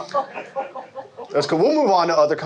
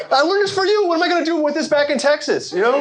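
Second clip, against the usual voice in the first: whining up high, pitch raised; high whiny voice; 9 semitones higher than usual. Retold or imitated. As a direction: complain in a high voice. Whiny American self.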